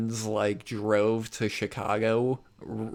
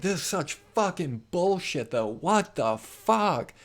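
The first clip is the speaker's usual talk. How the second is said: higher-pitched rant without real shouting; retold angry venting; 6 semitones higher than usual. Driver venting to Angela about his lost place, retold angry rant. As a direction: stretch out the swearing in mock outrage. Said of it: "driving around yelling".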